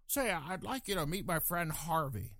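hammed-up impression of a film star; Jimmy Stewart. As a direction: do a deliberately bad movie-star imitation. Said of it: "I do the worst Jimmy Stewart imitation".

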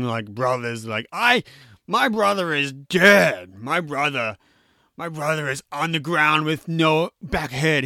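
slow, hammed-up movie rage; mock really angry. Bad guy confronts the man who hired him, hammed-up anger. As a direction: seethe slowly, leaning hard on key words. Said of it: "really angry".

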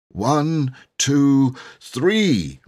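high, loud count building; counting up to a yell. Acted. Boy counting the children in to a yell. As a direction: count them in to scream.

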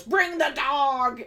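loud, drawn-out mock command; retold shouted command. Podcaster imitating Mia Goth ordering the dog, drawn-out shout.

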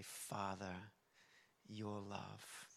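low, soft retold prayer; quietly recalled plea to God; simple prayer. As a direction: pray it simply, low and unhurried. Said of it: "A simple prayer".

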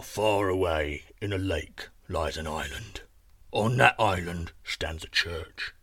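deep, slow giant intoning; ponderous giant with weighty pauses; confiding giant. Acted. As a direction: reveal the secret link by link, low and slow.

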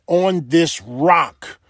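slow, drawn-out "On this rock", landing firmly on "rock"; emphasis on rock. Real, not acted. Preacher stressing Christ's words to the congregation, "rock" slow and emphatic. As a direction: stretch each word and lean on "rock". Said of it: "I know I'm putting emphasis on the rock".

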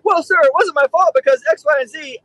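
high mock-whiny excuse; lightly voiced soldier making excuses. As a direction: soldier dodging blame before his captain. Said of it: "If they were like".

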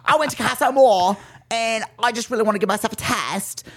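high, hammed-up British accent; British woman. British woman recounting Casa Amor drama, exaggerated accent. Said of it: "Me as a British woman".

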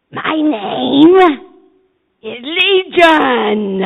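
very loud, slow demon roar. Acted. The demon speaking through the man, a loud slow roar. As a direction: boom it out like a monstrous demon. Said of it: "[name]'s rendition".

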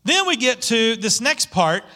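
usual speaking voice

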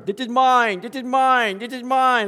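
put-on high, loud two-year-old insisting; toddler claiming things. Preacher imitating his toddler daughter, high and loud. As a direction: play a little girl grabbing toys, high and loud. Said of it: "my daughter Lindsay when she was two".

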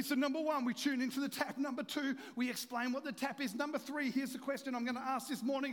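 high, loud, driving sermon delivery; full-force preaching. Real, not acted. Preacher urging congregation to get this, preaching at full force.

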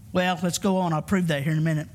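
normal talking voice

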